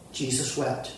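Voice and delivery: whispered-low solemn Jesus wept; hushed repeat. Shown as a demonstration. Repeated, softer and lower.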